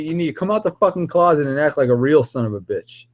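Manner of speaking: ordinary talk, nothing marked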